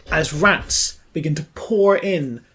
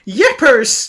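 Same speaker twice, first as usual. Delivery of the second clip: high, loud comic yelp; hammed-up cry of shock; startled exclamation; 8 semitones higher, 7 dB louder than usual. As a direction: yelp it in mock alarm. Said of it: "The woman with glasses goes".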